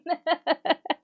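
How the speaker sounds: high giggle; emotional laughter. Real, not acted. Streamer moved by a birthday message, laughing. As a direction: laugh through the urge to cry. Laughs while about to cry again.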